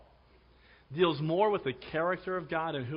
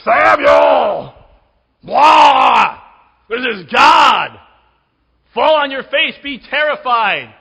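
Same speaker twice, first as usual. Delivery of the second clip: huge mock shout, higher and far louder; booming angry God; 8 semitones higher, 16 dB louder, much slower than usual. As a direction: bellow it like a terrifying god from the mountain. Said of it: "He doesn't come to Samuel and say".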